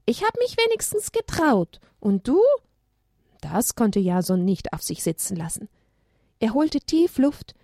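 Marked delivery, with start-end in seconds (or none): 0.0-2.4 put-on high, childish daring voice; child taunting. Child challenging Jason in a high teasing voice. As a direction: tease like a cheeky kid.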